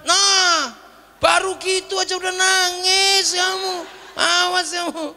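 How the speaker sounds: high, mocking jeer; mocking taunt. Bedouin jeering at the crying Prophet, high mocking taunt.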